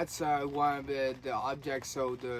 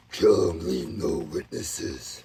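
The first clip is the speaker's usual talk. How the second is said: low, slow, menacing demon voice; evil voice; 7 semitones lower, 7 dB louder, much slower than usual. Retold or imitated. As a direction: growl it out like a sinister entity. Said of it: "all I hear is this evil voice".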